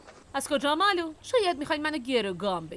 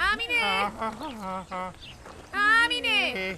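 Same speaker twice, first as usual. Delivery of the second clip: calling out; 4 semitones lower, much slower than usual. Acted. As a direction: call her from across the yard. Calling sister from afar.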